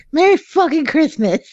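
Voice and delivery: usual speaking voice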